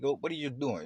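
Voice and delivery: mock scolding. Man reacting to another man getting emotional, mock scolding.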